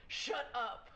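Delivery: booming lion roar voice; magically amplified voice. Pretend. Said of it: "make my voice like a booming, like, lion sound".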